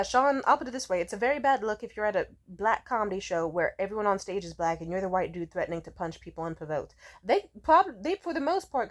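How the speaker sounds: normal talking voice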